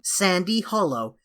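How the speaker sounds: ordinary delivery, nothing marked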